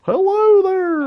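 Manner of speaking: put-on very high, drawn-out children's-show greeting; slow, sing-song dinosaur mascot impression; Barney. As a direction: greet the kids in a goofy high voice. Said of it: "Is that what Barney sounded like?".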